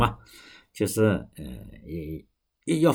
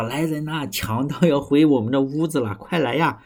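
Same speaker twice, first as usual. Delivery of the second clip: high, louder cry for help; hammed-up shout for help, voice pitched up; calling for help; 6 semitones higher, 3 dB louder than usual. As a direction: raise the alarm about the robbers. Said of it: "他就在这里喊".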